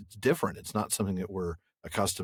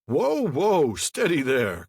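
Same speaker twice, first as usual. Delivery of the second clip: loud, raised call to halt; old man's cry; 7 semitones higher, 5 dB louder, much slower than usual. A narrator's reading. Old man halting running hobbits, crying out loudly. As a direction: call out to stop runners in their tracks. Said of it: "cried the old man".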